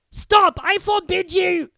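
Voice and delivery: desperate to silence him; panicked yell. Acted. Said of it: "yelled Uncle Vernon in panic".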